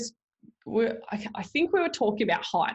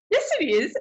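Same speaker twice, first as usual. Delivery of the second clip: high, louder mock insistence; stubborn insistence; 9 semitones higher, 5 dB louder than usual. Wife insisting to husband, high mock stubborn. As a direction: dig in, half laughing.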